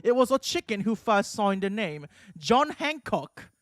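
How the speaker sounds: hammed-up British lecturing; British professor voice. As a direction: build to the name in a put-on British accent. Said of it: "British voice, and my British accent".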